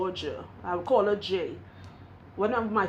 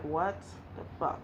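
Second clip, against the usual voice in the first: hushed, low stunned reaction; stunned disbelief; 5 semitones lower, 8 dB quieter than usual. Retold or imitated. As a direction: drop low and soft, as if in shock. Stunned reaction to friend's cancer text.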